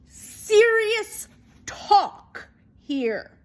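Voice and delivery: ordinary delivery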